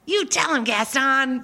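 high, lightly voiced sidekick cheer; a hint of Olaf egging on Gaston. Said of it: "that voice of Olaf".

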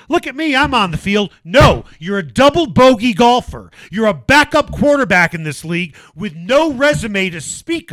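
heated rant. Real, not acted. Host rants to co-host about Lock, loud and heated. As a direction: let the anger build through the rant. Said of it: "this is where I go on my rant".

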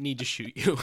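apologetic Canadian villain, half laughing; Canadian accent. Acted. Villain apologising to a captive, in a light Canadian accent. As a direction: play an overly polite Canadian, amused. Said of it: "very not quite lit Canadian".

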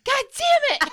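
high, loud outburst of frustration; frustrated shout. Retold or imitated. Told to give away her bracelet, loud frustrated shout. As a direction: yell it like you've just lost.

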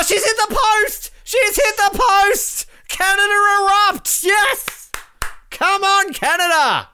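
very high, loud, elated match call; ecstatic commentary shout. Real, not acted. Fan calling a penalty shootout for Canada, ecstatic shouting. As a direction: erupt into a high-pitched celebratory yell.

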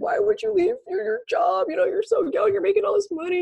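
high-pitched mock mom voice, pleading; imitating worried mom. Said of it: "She's like".

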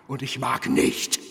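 usual speaking voice

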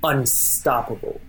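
measured royal decree with weighty pauses; intent, kingly. Acted. King addresses his generals from the throne, lightly kingly and deliberate. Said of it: "he speaks with intent".

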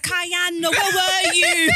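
high, slow laughing yell; laughing shout. Retold or imitated. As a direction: shout it while cracking up. Imitating host shouting, laughing.

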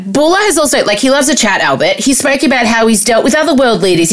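ordinary talk

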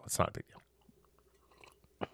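slow breathy whisper of It's not a big deal. Shown as a demonstration. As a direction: whisper it slowly, almost silent.

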